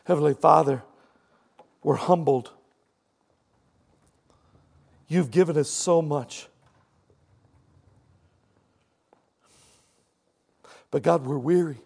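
humble prayer with long pauses. Acted. Closing prayer.

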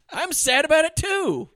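high, loud mock defensiveness; exasperated protest. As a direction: plead your case, hammed-up. Acting out son's protest at funeral.

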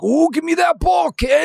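put-on jokingly yells at the boy, pitch leaping way up; mock shout. Dad calling out the kid who hit the Zuka, mock shout. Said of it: "I'd be like".

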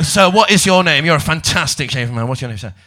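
ordinary talk

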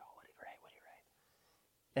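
hushed whisper, the second question slow; curious crowd whisper. Retold or imitated. Crowd around Jesus whispering about his writing, hushed. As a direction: whisper like onlookers straining to see. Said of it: "everyone's like".

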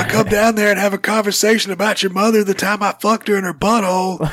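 gruff wrestler promo voice; Stone Cold Steve Austin impression. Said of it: "I'm also got Stone Cold Steve Austin".

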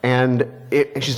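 ordinary talk

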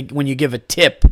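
ordinary talk

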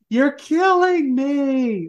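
high, drawn-out mock scream; hammed-up cry of anguish. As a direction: scream it melodramatically, stretched out and high. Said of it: "he screams out to Tim".

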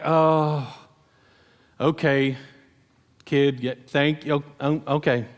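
low, halting acted acceptance; sheepish reluctance. Men in line answer the kid who volunteered, sheepish. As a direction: hesitate and concede in small pieces.

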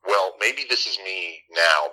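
ordinary delivery

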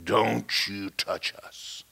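very slow, drawn-out demonic man's voice. Retold or imitated. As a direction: drag it out as a demon speaking through someone. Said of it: "a man spoke to me out of her".

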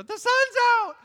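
high, loud, joyful shout; excited kids. Retold or imitated. Children shouting as the sun comes out, excited. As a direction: cheer like it's Christmas.

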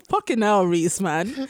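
usual speaking voice

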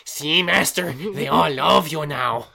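slightly high fawning servant; lightly voiced sycophant. [name] flattering Arcane, lightly fawning servant voice. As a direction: fawn over your master. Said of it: "he's basically like".

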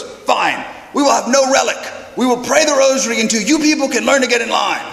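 high, emphatic mock ultimatum; mad priest. Priest threatens to withhold the relic, angrily imitated. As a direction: lay down the law as a fed-up priest. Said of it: "this priest is getting mad".

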